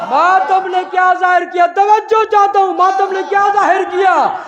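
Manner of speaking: very high, very loud shouted oratory; fiery climax preaching. Acted. Preacher naming Husain's killers to mourners, shouting. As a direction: rage at the killers at the top of your voice.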